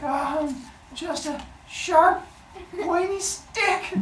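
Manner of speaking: high, slow, sly innocent voice; fake innocent. Cronus explains the weapon to his father, high and coy. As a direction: play dumb about the knife behind your back.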